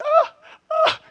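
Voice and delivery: high-pitched acted "ah" of pain; hammed-up ouch; mock yelp of pain. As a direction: yelp as if hopping on a hurt knee. Acting out knee pain while dancing.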